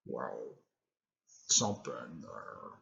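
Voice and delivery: very low, soft monster murmur; deep, hushed creature voice; beast voice. Retold or imitated. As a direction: drop right down for a quiet beast. Voicing the beast in story.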